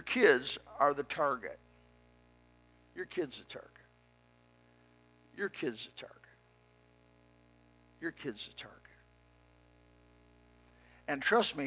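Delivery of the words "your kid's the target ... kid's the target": very soft, whispery warning; hushed warning. Real, not acted. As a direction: drop to a near-whisper, low and grave. Hushed repeated warning to parents.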